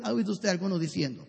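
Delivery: normal talking voice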